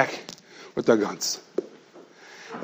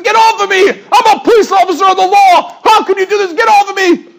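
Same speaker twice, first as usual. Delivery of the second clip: high yelled protest; outraged shouting; 13 semitones higher, 5 dB louder than usual. Retold or imitated. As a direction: yell it like you're wrongly grabbed. Fake cop protests loudly.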